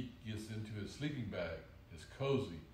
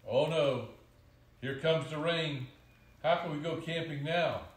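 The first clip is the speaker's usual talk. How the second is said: loud, higher-pitched storybook dismay; raised, urgent read-aloud voice; dismayed alarm; 6 semitones higher, 7 dB louder than usual. A narrator's reading. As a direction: cry out as the rain spoils it. Child dismayed as rain starts.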